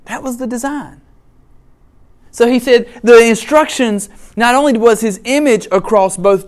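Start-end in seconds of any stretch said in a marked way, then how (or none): none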